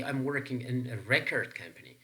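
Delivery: "record" as REC-ord in the example; stress on first syllable. Shown as a demonstration. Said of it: "stress pattern on the first syllable".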